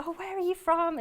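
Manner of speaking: high-pitched, eager retold greeting; retold excitement. Army wife retelling meeting people from back home, acted excitement. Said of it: "used to get quite excited".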